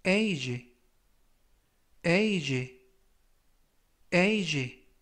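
age read carefully with a falling close; model pronunciation. Shown as a demonstration. Teacher modelling the word for students, careful pronunciation. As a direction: model age for learners to repeat. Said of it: "라고 발음하시면 되겠습니다".

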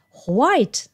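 ホワイト opened with a clear "ho"; katakana English. Shown as a demonstration. Teacher showing a habit stuck in learners' heads, katakana ho-sound in ホワイト. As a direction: say ホワイト the Japanese way, ho-wai-to. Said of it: "カタカナが頭にこびりついている".

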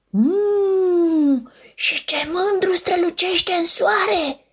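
usual speaking voice

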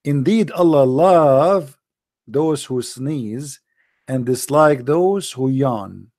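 very slow solemn recital. Acted. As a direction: drag each word out.

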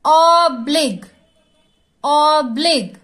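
Oblique in two loud, stretched beats; syllable by syllable. Shown as a demonstration. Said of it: "एक एक word हमको जोर से बोलना है".

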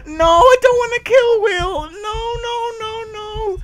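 high, hammed-up crying protest; mock wailing plea. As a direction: wail as if forced to do it. Begs not to kill character.

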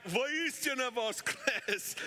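mild retold shout; crowd shouting back. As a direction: call it back like the whole hall answering. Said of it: "everyone shouted back".

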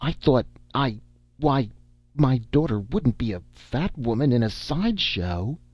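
stammer, then protest in dismay; flustered exclamation. A narrator's reading. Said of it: "he exclaimed".

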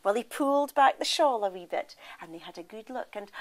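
usual speaking voice

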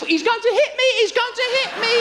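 high, hammed-up cry for help; mock wailing wife. Wife shouting to shoppers for sympathy, hammed-up high wail. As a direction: play the victim to the shoppers.

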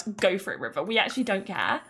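usual speaking voice

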